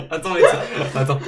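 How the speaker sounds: talking through a high laugh. Real, not acted. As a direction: burst out laughing mid-word. Fou rire au montage. (en plein fou rire)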